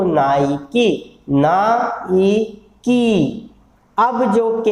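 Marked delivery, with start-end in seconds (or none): 0.0-3.0 "नाइकी" very slowly, na-i-kee pulled apart; pronunciation drill. Shown as a demonstration. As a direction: draw out "नाइकी" for students to repeat. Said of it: "अब बार बार बोलिए इसको". ("नाइकी" बहुत धीरे, ना-ई-की खींचकर)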